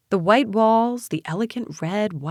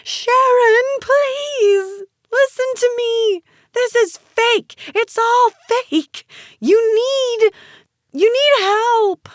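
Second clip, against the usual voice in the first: very high, loud, desperate screaming; frantic drawn-out scream; 6 dB louder, slower than usual. A narrator's reading. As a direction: plead at full scream, stretching the words. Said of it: "I screamed".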